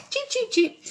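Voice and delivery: very high, thin chick cheeping; chick cheeps. Retold or imitated. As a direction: pipe it like a just-hatched chick. Imitating newly hatched chicks.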